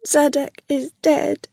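mildly tearful, high-pitched voice. A narrator's reading. Rilla crying as she tells Kia, tearful. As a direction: break the news in a high, grieving voice. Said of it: "began to cry".

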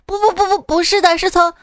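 put-on high-pitched kid rattling off sounds; child protesting, then chanting the syllables; insistent child. Child correcting mother's homework guess, high insistent kid voice.

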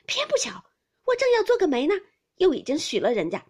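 loud, high-pitched cry of regret; exasperated lament. A narrator's reading. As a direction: lament it loudly, as if stamping in frustration. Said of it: "便唉声跺脚地说". (唉声跺脚地高声惋惜)

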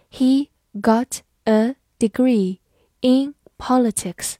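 slow, careful word-by-word reading. Shown as a demonstration. Teacher reading a model sentence slowly for students. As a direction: read it slowly so students can follow. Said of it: "好，慢慢来读。".

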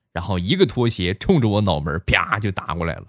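normal talking voice

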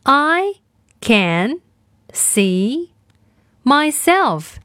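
I, can, see, myself each said alone with long gaps; word by word. Shown as a demonstration. Teacher splitting the sentence for kids to repeat. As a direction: break the sentence into single words. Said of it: "我们来练习今天的跟读作业".

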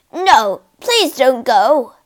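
loud, slow begging; pleading. Acted. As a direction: beg her not to leave. Ghost begs Virginia to stay.

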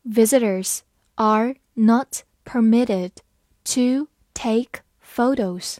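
slow, word-by-word teaching read. Shown as a demonstration. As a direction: read it slowly, pausing between words. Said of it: "好，慢读一遍". (慢读，一词一顿)

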